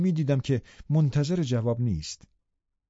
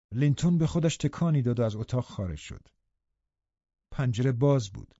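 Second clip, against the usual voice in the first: ordinary delivery, nothing marked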